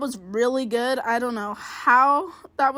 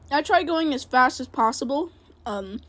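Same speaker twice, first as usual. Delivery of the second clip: ordinary delivery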